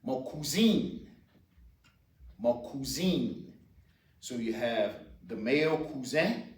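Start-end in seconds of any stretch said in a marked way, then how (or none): 0.0-3.5 "cousine" with a long ee and a nasal n ending; French pronunciation. Shown as a demonstration. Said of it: "you will hear them say".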